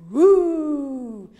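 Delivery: loud playful roar; mock tiger roar. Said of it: "Tout le monde en rugit".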